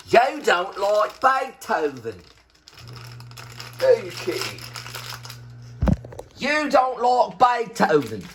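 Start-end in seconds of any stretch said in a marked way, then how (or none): none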